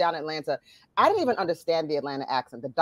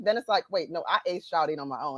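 ordinary talk

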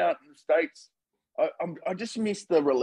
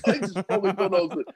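normal talking voice

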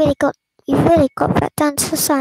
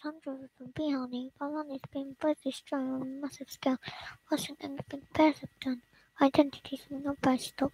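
whisper; 11 dB quieter than usual. Acted. Hushed news-like report.

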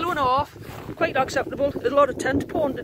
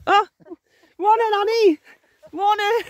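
calling out; 9 semitones higher, 7 dB louder than usual. Real, not acted. Calling good morning to Annie.

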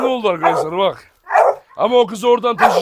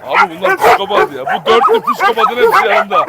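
exasperated excitement; 11 semitones higher, 5 dB louder than usual. Real, not acted. Exasperated at wild dogs.

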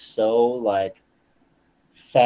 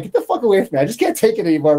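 raised, high retold outburst; retold snapping; 7 semitones higher, 4 dB louder, faster than usual. Sending the salt-pushing teammate away, retold angry snap. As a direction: act out sending someone away, fed up. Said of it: "I snapped".

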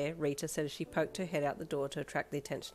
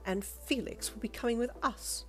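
higher, lightly haughty voice; dismissive woman customer; 6 semitones higher than usual. A narrator's reading. Lady directs her demand at Lolita, lightly dismissive. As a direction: press the demand, slowing at the end.

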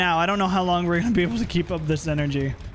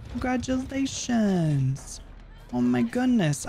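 high, slow mock congratulations; sarcastic praise; 5 semitones higher, slower than usual. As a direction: drip fake cheer onto the loser. Sarcastic congratulations to hooked survivor.

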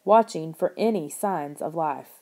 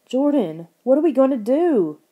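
higher, louder despairing wail; 6 semitones higher, 5 dB louder than usual. A narrator's reading. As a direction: cry out helplessly. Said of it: "Lauren wailed".

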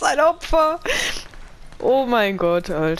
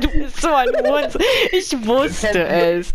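laughing; 4 semitones higher than usual. Real, not acted. Laughs after rage.

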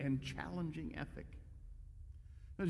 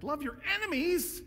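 mock disbelief; 10 semitones higher than usual. Incredulous echo of Jesus' command.

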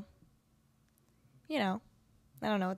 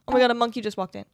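ordinary delivery

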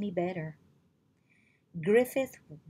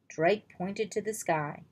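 usual speaking voice

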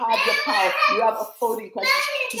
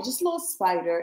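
ordinary talk, nothing marked

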